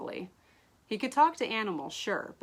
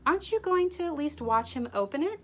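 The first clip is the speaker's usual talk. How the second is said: higher, pointed suspicious question; 9 semitones higher, 5 dB louder than usual. Acted. Diamond suspiciously pressing Ash to stay and watch. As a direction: sound like you've caught him out. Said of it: "Diamond asked suspiciously".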